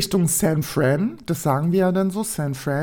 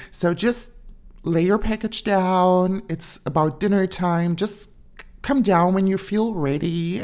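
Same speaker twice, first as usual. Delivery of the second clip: dorm leader; 2 semitones higher than usual. Retold or imitated. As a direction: imitate a sweetly welcoming American dorm head. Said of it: "die, ähm, Leiterin sagt dann so zu uns".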